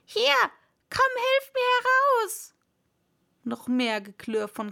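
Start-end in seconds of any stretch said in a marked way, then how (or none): 0.0-2.5 high, loud, urgent call; irate cry for assistance; shouting for help. A narrator's reading. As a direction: yell for the servant to pull you out. Rabbit yells for help.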